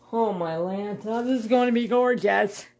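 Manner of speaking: high, loud gushing; building to a high, loud squeal of delight; thrilled. Real, not acted. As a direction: gush with rising excitement. Thrilled by how painting will look.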